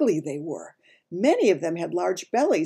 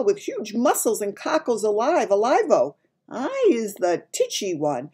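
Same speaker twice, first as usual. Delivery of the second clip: higher-pitched giant, explaining emphatically; earnest giant; 7 semitones higher than usual. A narrator's reading.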